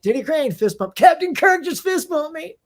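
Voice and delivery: high, loud giddy inner voice; inner fanboy thrill. Retold or imitated. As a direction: gush like a starstruck fan. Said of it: "in my head, I'm like".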